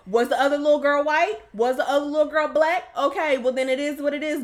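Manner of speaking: loud, high, hammed-up outrage; indignant parent. Mother at parent-teacher conference, indignant.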